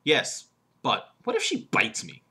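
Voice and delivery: slow, spaced lead-in, "bites" punched; stress on bites. Shown as a demonstration. Coach demonstrates the correct one, stress on "bites". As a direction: pause after "yes" and "but", then lean hard on "bites". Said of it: "Emphasis on bites".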